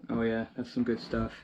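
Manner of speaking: ordinary talk, nothing marked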